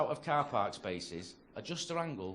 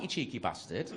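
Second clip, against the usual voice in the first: normal talking voice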